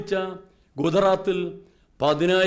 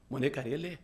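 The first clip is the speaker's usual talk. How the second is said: put-on much softer and lower, a mother hushing her child; soothing a crying baby; 6 semitones lower, 10 dB quieter than usual. Mother in the ATM queue hushes her crying baby, softly. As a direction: hush the baby gently. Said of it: "ആ സ്ത്രീ കുഞ്ഞ് കരയുമ്പോൾ പറഞ്ഞു".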